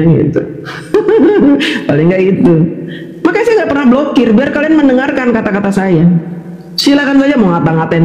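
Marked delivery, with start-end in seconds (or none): 0.4-1.8 real, breaking-out chuckle; amused laugh. Real, not acted. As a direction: laugh at your own imagined convert. Geli menirukan calon pendengar. (tertawa geli)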